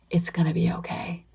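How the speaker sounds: whispered promise that all is well; hushed words to calm a worried parent; reassuring whisper. Real, not acted. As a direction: breathe it out softly, as a comfort. Reassuring listeners about letting go.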